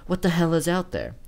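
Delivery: panicked question. A narrator's reading. Narrator hearing the door shake, panicked. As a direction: cry out in fear.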